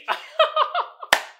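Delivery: airy high-pitched cackle; 1 high wheezing laugh. Real, not acted. Cracking up at his own joke, high wheezing laugh. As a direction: let a breathless laugh out.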